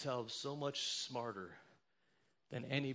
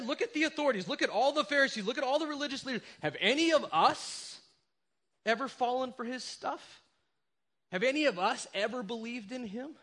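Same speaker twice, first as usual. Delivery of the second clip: high, loud, proud boasting; smug Pharisees; 9 semitones higher, 7 dB louder than usual. Acted. Pharisees boasting to their officers, high and smug. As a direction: puff up with superiority.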